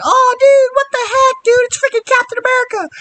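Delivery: high-pitched squealing fan voice; excited crowd. Retold or imitated. Townsfolk cheering the returning hero, shrill and excited. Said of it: "everybody's like".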